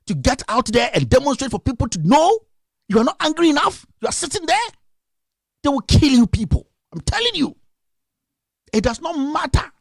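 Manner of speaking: high-pitched, indignant scolding; angry exhortation. Real, not acted. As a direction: push the pitch way up and berate them for not acting. Heated rant scolding apathetic Ghanaian youth.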